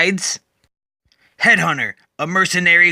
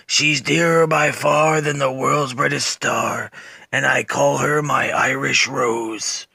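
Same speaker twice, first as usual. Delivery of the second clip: recited poem; 2 semitones lower, slower than usual. Acted. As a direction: recite it slowly like a lullaby. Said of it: "says again his father's poem".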